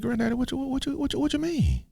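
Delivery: put-on high, small, stammering boy; stuttering, hurt grandson. Grandson hurt by granddaddy's question, stuttering. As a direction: stumble over the words in a squeaky kid voice. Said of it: "when you start stuttering".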